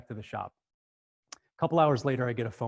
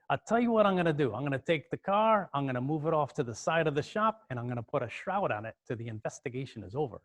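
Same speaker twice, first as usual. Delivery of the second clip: New York mechanic laying out a plan; New York accent. Retold or imitated. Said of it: "this kinda New York accent".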